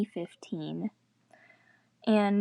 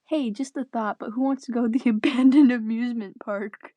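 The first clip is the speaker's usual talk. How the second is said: louder, eager invitation; cheerful chirp; 2 semitones higher, 6 dB louder than usual. Acted. Chloe dares her friends toward the park, louder and eager. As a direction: pitch the dare brightly, louder. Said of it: "Chloe chirped cheerfully".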